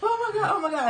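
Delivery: high, panicked squeal; freaking out. Retold or imitated. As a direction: squeal in total disbelief. Freaking out at proposal.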